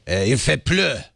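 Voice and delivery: hammed-up French-Canadian accent; mock French accent. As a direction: put on a playful French accent. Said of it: "I don't know what accent that is".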